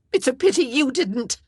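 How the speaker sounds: high, loud, bitter; flung-back retort. A narrator's reading. Wife retorts to husband, bitter and loud. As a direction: throw it back at him. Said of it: "she flung back".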